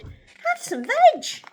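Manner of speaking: very high, loud, slow offer; hooting. A narrator's reading. Narrator voices duckbills welcoming Drip to eat, hooting. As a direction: hoot a gleeful invitation. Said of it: "And they hooted".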